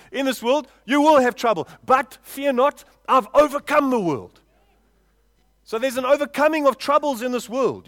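emphatic preacher, driving each phrase home; full-force preaching. Real, not acted. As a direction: proclaim it to the whole congregation.

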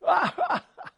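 high, hearty mock laugh; head-back laugh. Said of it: "puts his head back and laughs".

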